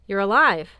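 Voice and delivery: loud, rising cry of relief; relieved. Acted. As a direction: burst out in dizzy relief. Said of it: "dizzy with a relief".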